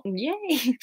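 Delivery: high but soft little yay; a muted cheer, just in case; small hedged cheer. Real, not acted. Hedged cheer if it's not you.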